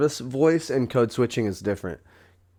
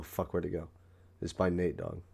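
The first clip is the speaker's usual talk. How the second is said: ordinary delivery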